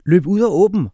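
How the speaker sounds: high, loud order; father shouting. A narrator's reading. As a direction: bark the order over the noise. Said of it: "råbte far".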